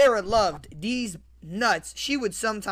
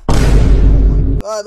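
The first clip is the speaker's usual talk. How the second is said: long loud unvoiced shriek; real screaming oh my god; loud breathy scream; 18 dB louder, much slower than usual. Real, not acted. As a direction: scream it out in shock. Scream at picture.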